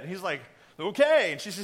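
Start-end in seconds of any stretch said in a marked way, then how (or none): 0.8-1.3 big, high, hammed-up agreement; booming comic assent; king's loud okay. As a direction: play an over-eager yes. King agrees to another banquet.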